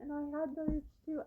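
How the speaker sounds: ordinary talk, nothing marked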